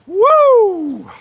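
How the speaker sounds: high, loud "woo"; pretend meth whoop. Speaker imagining themselves on meth to viewers, mock wild whoop. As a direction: let out one long, high, wired whoop. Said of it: "Could you imagine me on meth?".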